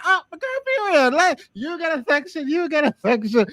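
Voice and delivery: high, thrilled hype to a friend; excited girl talk. Retold or imitated. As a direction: gush over getting a section, up high. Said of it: "We're like".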